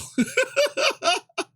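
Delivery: shrieking high laugh; real high-pitched cackle. Real, not acted. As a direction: let a high squeal of laughter out. Laughs at own joke.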